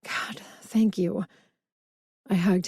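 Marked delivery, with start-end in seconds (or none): 0.0-1.2 slow unvoiced whisper through held-back tears; whispered gratitude, fighting tears; tearful whisper. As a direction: choke out the thanks in a hush. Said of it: "swallowed back more tears".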